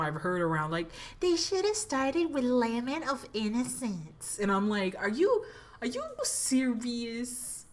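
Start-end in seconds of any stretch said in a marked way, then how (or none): 1.2-4.4 mocking critic voice. 5.0-7.6 incredulous. Retold or imitated. Incredulous reply.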